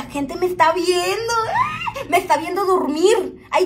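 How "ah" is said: very high short ah of embarrassment; mock shriek. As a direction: squeal a quick ah.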